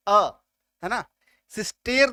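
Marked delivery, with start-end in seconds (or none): 1.5-2.1 सिस्टेर with a long "ay" in the last syllable; wrong vowel. Shown as a demonstration. Teacher showing what we don't say, सिस्टेर with "ay". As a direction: mispronounce it as सिस्टेर, rhyming with "stare". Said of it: "तो नहीं बोल रहे हैं".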